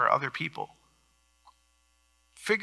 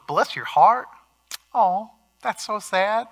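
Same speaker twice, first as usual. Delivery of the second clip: higher, drawn-out Southern Midwest sympathy; put-on Southern Midwest commiseration; Southern Midwest accent; 6 semitones higher, slower than usual. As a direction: mimic Southern Midwest pity, stretching the words. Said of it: "Southern Midwest accent there".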